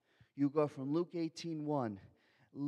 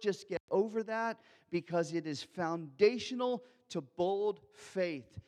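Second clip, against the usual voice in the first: ordinary talk, nothing marked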